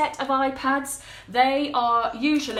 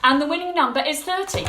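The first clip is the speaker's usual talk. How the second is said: excited announcing; 5 semitones higher, 6 dB louder, faster than usual. Real, not acted. Announcing raffle winning number.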